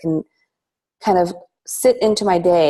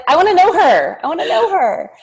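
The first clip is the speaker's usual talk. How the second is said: high, louder gushing quote; eager retold exclamation; 11 semitones higher, 5 dB louder than usual. Guest tells host of first seeing her, eagerly. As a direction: replay an eager reaction, pitched up and loud.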